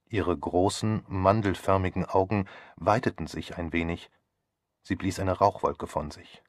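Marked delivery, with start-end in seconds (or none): none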